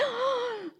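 big, high mock gasp; sharp imitated intake of shock. Students react with shock.

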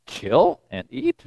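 soft, slow, rising in disbelief; puzzled questioning. Retold or imitated. Peter puzzles over unclean animals, hushed incredulous question. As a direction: echo the order as a quiet question.

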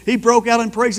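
normal talking voice

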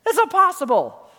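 the crowd finds the promise absurd; incredulous crowd. Retold or imitated. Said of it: "That's what they're thinking".

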